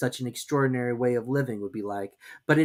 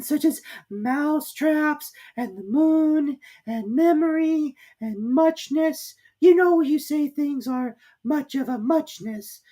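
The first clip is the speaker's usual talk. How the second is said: high, slow, drawn-out mouse voice; slow squeaky Dormouse; 7 dB louder, slower than usual. A narrator's reading. Dormouse answering Alice after long thought.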